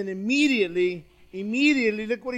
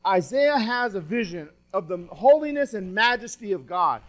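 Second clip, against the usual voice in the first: ordinary talk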